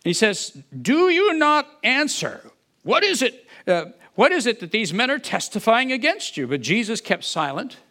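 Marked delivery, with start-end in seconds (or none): 0.8-6.5 Caiaphas demanding. Acted. High priest questioning a silent prisoner, demanding. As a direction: press the prisoner for an answer.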